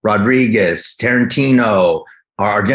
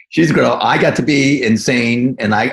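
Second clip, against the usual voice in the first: usual speaking voice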